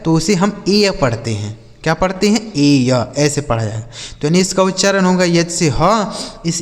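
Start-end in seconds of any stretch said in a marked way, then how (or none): 2.6-3.1 ए या as two sounds, "ay-ya"; low, teacherly "ay-ya" for ए या; demonstrated vowel glide. Shown as a demonstration. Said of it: "ए का उच्चारण आपको ए कर देना है".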